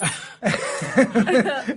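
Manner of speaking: high laugh fading out. Real, not acted. Laughing at own joke.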